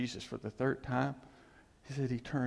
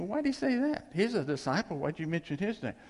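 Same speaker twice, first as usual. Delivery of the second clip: high-pitched questioning reader; lightly acted bewilderment; mock puzzlement; 6 semitones higher than usual. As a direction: ask it as a baffled reader, pitch up.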